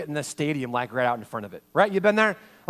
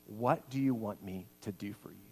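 gentle question; 8 semitones lower, 10 dB quieter than usual. Acted. Jesus asking at lunch.

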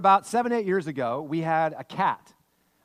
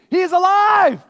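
shrieked, much higher and louder; scream; 14 dB louder, slower than usual. Retold or imitated. Dad yelling to his family about the living bird, shrieking. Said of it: "And I scream".

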